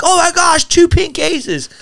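high, squealing excited shriek; mock freak-out. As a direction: squeal it like a starstruck fan. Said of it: "so I freak out".

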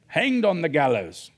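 hammed-up Australian pirate accent. Preacher replays the gallows verse for laughs, mock pirate accent. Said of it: "all pirates speak with Australian accents".

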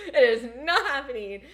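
cracking up while swearing he won't go over; laughing while quoting herself. Real, not acted. Laughing defiant retelling.

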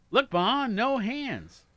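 put-on high, loud childlike boast; excited showing off. Digital clock to its mother, excited showing off. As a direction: call out to Ma in a high, excited shout.

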